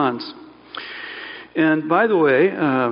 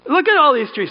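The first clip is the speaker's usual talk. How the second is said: enthusiastic showing off; 10 semitones higher, 4 dB louder than usual. Acted. Preacher voicing positive God framing.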